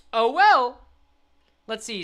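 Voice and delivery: ordinary delivery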